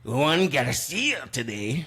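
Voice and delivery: put-on slow, Spanish-accented "Juan Garcia"; mocking anchors, slow Spanish accent; newscaster Spanish accent. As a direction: anchor rolling the name in Spanish. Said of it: "They're like".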